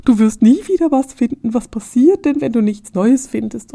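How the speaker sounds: hammed-up doomsayer alarm; mock panic. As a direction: play the worried crowd spiraling into panic. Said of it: "dass alle Leute dir sagen".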